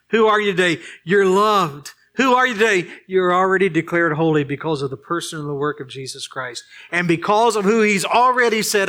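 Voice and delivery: loud, high, emphatic preacher's delivery; rousing pulpit cry, pitched high and loud; impassioned preaching. Real, not acted. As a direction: preach it out at full force. Preaches at full force.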